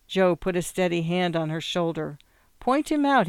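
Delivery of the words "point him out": raised-pitch urgent command; insistent instruction. A narrator's reading. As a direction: order it firmly, pitched high. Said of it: "he commanded".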